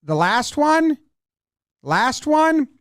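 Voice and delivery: high, rising query; imitated puzzled question. Pitcher and catcher confused on the mound, high puzzled question. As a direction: push the question up high, as if checking.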